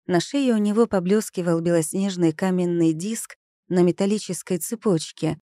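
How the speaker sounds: ordinary delivery, nothing marked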